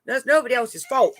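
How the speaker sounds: ordinary talk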